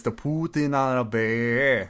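slow, stretched Russian accent. Shown as a demonstration. Insisting the meme needs it, slow Russian accent. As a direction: draw it out with Russian vowels. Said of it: "That's the Russian ac".

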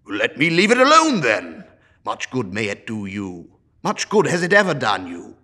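put-on loud, curt, annoyed old man; irritable dismissal. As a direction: brush the boy off. Scrooge dismisses nephew.

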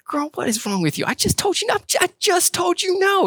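high-pitched mock exasperation; exasperated scolding. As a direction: scold a toddler in a squeaky, worked-up pitch. Acts out exasperated scolding of toddler.

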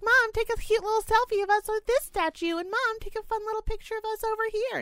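high, sing-song mock daughter voice; mocking bratty daughters. Mother mocking other moms' daughters, high squeaky imitation. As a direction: mimic spoiled girls begging mom for photos.

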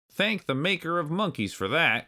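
high, crabby granddad retort; exclaimed. A narrator's reading. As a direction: jeer it like a cantankerous old-timer. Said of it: "Cranky Kong exclaimed".

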